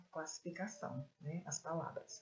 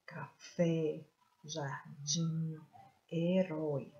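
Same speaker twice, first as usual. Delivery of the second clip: "café, jardim, herói" each drawn out on its last syllable; stressed last syllables; much slower than usual. Shown as a demonstration. Teacher locating the strong syllable, "café, jardim, herói" stressed on their ends. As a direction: lean on "fé", "dim", "rói" in "café, jardim, herói". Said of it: "A sílaba tônica é a sílaba forte".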